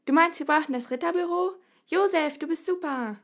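high, loud, delighted villager; excited. A narrator's reading. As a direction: burst out with enthusiasm. Villagers cheer Josef's idea. (begeistert, hoch und laut)

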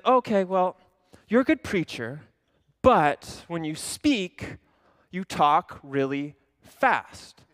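slow, measured, gently teasing; mock-patient mentor, deliberately slow; slow deliberate advice. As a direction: drag it out to show the point. Elder's advice on speaking fast.

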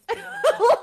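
high, loud laugh; burst of laughter. Real, not acted. Laughing at own spanking remark.

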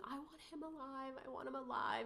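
high, soft mock scream; retold child's scream. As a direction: imitate a child's cry without raising volume. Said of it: "he just starts screaming".

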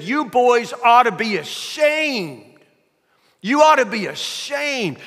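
high, drawn-out scolding screech; screaming old lady. Retold or imitated. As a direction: scold them as if calling from far away. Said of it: "she continues to scream like she was far away".

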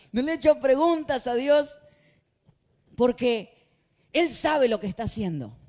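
loud, high mocking repeat; mock the proud believer loudly; mock pious boast. Preacher mocking a proud believer, loud and high.

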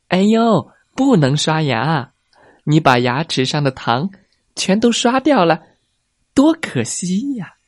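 high, sly fox voice coaxing the tiger, rising on 全都刷掉了; a cunning fox pretending to care. Fox stopping the tiger from brushing, high and wheedling.

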